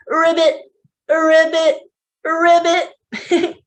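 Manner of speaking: high croaky ribbits; high playful ribbit; frog ribbit. Shown as a demonstration. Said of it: "What sound does our frog make?".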